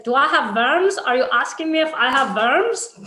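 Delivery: "worms" with a v, swooping up at the end; imitated mispronunciation. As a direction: mimic the man's puzzled question, "worms" as rising "verms". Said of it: "And he's like".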